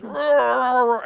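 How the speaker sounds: loud, high roar; big mimicked whoosh of fire; imitated roar. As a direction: roar out like bursting flames. Imitates flame roaring from the hand.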